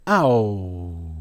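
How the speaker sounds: Ow as a long falling glide; drawn-out Ow for the class to copy; demonstrated "ow" sound. Shown as a demonstration. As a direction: hold Ow, sliding down to "oo". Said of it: "which gives you the pronunciation".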